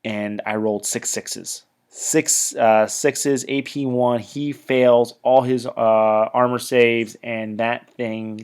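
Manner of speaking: ordinary delivery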